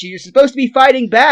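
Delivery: mildly yelled stage direction; exasperated director, only half shouted; imitating a yelling director. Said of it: "the director's yelling at".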